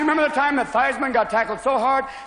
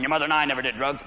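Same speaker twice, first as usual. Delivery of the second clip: lower, fast, jittery dad; wired father; 7 semitones lower, 3 dB quieter, faster than usual. Retold or imitated. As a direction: rattle it off like an overcaffeinated dad.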